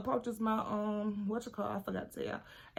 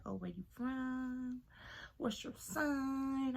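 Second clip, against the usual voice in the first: slow, higher, drawn-out nosy voice; mock chatty girl; 4 semitones higher, 8 dB quieter, much slower than usual. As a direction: imitate her prying sweetly. Mocking the chatty cousin.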